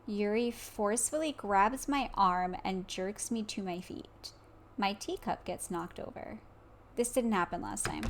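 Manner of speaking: ordinary talk, nothing marked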